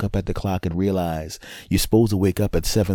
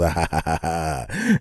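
acted gloating laugh; gleeful laugh. Husband watching her wrangle the girls, gleeful laugh. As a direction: laugh at someone else's struggle.

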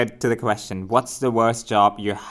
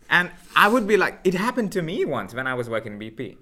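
ordinary talk, nothing marked

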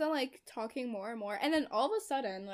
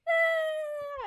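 high, loud mock baby wail; imitated baby cry; 7 dB louder than usual. Friend retelling the plot, imitating baby Harper's cry. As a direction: wail like a crying infant. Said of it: "Harper's like".

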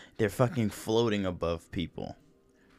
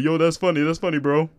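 high, loud imitation of someone cracking up; amused hype quote; 6 semitones higher, 7 dB louder, faster than usual. Imitating his reaction when I say something funny, loudly amused. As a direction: mimic an over-amused bro reaction. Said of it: "be like".

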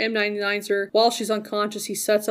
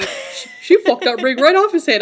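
mock quote; 5 semitones higher, 9 dB louder than usual. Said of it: "you're like".

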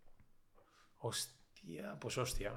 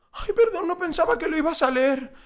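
squeaky, much higher mock-innocent voice; mock sheepish apology; 13 semitones higher than usual. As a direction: play the caught-out sender, sweet and squeaky. Mocking a sheepish reply. (disculpa burlona, con voz aguda de inocente pillado)

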